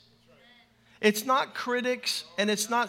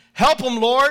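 loud, higher call rising at the end; congregation call-out; 4 semitones higher, 9 dB louder than usual. Shown as a demonstration. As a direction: call it out like a church response. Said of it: "Here's the first one".

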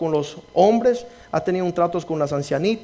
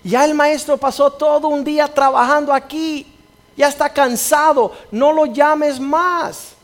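loud rebuke; 10 semitones higher, 8 dB louder, slower than usual. Retold or imitated. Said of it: "Y lo reprendían".

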